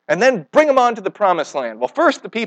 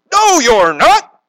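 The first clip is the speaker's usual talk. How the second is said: higher, louder, slow emphatic refusal; Peter protesting; 9 semitones higher, 6 dB louder, much slower than usual. Retold or imitated. Peter refusing to let Jesus die, with a loud slow protest. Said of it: "Peter says".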